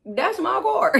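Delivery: high, laughing disbelief as he drives off; startled laughing protest. Retold or imitated. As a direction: call after him, half laughing, that's my car. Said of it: "and I'm like".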